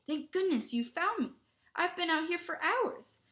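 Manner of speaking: high, plaintive burst of relief; relieved. A narrator's reading. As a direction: pour out relief at being found. Lost boy relieved at being found.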